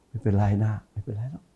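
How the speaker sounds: soft, gentle "ไม่เป็นไรนะ ไม่เป็นไรหรอก" said to let it go; forgiving reassurance. Shown as a demonstration. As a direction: reassure them it's all right, quietly. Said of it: "ให้อภัยอโหสิกรรม". (พูดปลอบเบาๆ อย่างให้อภัย)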